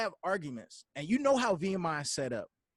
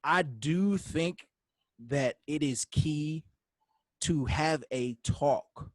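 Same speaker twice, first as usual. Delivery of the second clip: each phrase weighed, slowly; slow and deliberate; 2 semitones lower, much slower than usual. Real, not acted. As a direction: lay the point down word by word, slowly. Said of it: "slow emphatic point".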